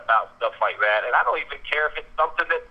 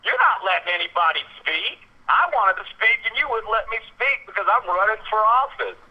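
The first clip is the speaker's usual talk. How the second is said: retold holler; 8 semitones higher than usual. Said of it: "I hollered real loud".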